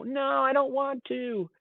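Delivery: put-on high, slow whiny four-year-old; whining child. Four-year-old refusing to clean up, high slow whine. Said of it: "He immediately just began whining".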